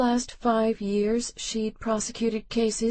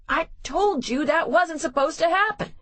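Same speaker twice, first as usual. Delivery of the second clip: raised higher, insistent protest; getting louder; 9 semitones higher than usual. A narrator's reading. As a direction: push it up, almost shouting. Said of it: "getting louder".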